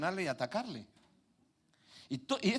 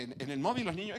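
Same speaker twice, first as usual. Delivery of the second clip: ordinary talk, nothing marked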